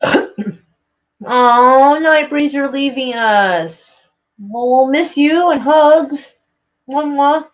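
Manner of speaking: high, drawn-out affectionate goodbye; cooing farewell. Real, not acted. Streamer saying goodbye to a leaving viewer, cooing. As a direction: coo it slowly, high and stretched out.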